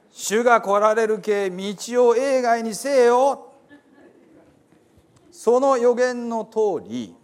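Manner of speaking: earnest folksy Bible reading; dialect scripture reading. Acted. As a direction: proclaim the Gospel like a storyteller from Hiroshima. Reads Gospel in Hiroshima dialect.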